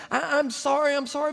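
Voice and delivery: high, timid pleading; apologetic guide pleading with the boss; pleading Carlos. Retold or imitated. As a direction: beg him not to be angry.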